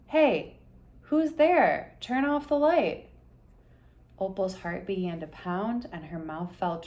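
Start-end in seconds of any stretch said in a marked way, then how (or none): 0.1-3.1 loud, grumpy bark from the dark; grouchy voice. A narrator's reading. Sleepy bat grumbles at the intruder, loud and cranky. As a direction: woken up and cranky, snap at the light. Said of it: "a grouchy voice echoed through the cave".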